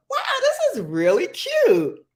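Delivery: high, excited squeal of delight. Retold or imitated. Reacting to the new microwave, a high delighted gush. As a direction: gush over something cute. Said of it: "I was like".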